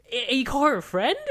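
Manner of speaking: high, loud indignant cry; calling out the fake friend, loud and indignant; outraged. Outraged quote at movie character.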